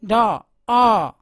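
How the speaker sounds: डॉ then आ, the vowel stretched long; drawn-out vowel demo. Shown as a demonstration. Teacher stretches the vowel in dog for students. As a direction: hold the आ out slowly. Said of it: "डॉग में आ के बोलते समय".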